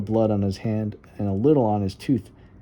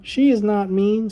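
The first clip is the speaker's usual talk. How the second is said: put-on high, eager child's voice; little girl voice; 11 semitones higher, 5 dB louder than usual. Little Violet telling her brother about the dark, high and earnest. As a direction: play the small sister talking herself brave.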